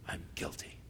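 breathless whisper of guilt; a sinner suddenly facing guilt, whispered; whispered confession. Pretend. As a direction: whisper it as it dawns on you.

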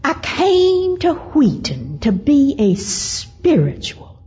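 high, slow, drawn-out Southern lament; Southern accent. Retold or imitated. House mother telling student her troubles, imitated plaintive Southern accent. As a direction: imitate a woeful older Southern lady, stretching every vowel. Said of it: "with that Southern accent".

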